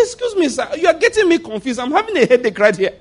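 high, mock-confused pleading; mock exasperation. Pastor pretending confusion at the man's logic, high and exasperated. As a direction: play it up as baffled and overwhelmed.

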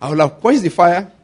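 ordinary delivery

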